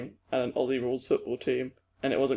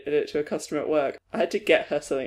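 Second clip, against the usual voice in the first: usual speaking voice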